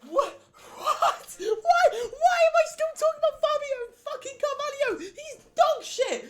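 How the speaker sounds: very high shrieking laughter; laughing scream. Real, not acted. Hears own old prediction.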